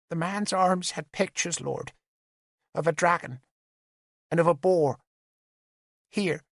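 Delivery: put-on high, small child's voice, reciting; a nine-year-old saying rehearsed words; young girl. Little witness telling the lords her loss, childlike recital.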